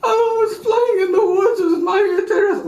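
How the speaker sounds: put-on high sobbing little girl voice; mock crying child. Girl crying to her mother about the woods, mock sobbing. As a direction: sob it out like a ten-year-old. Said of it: "child telling mother, crying".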